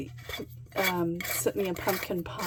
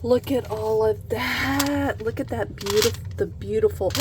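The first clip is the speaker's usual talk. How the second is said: ordinary talk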